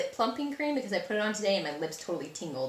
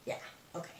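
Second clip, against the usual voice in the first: whispering; 12 dB quieter, much faster than usual. Real, not acted. Quiet aside to herself.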